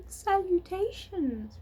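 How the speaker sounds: high, slow, elegant greeting; thin voice. A narrator's reading. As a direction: savour the fancy word, slowly. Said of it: "repeated the voice".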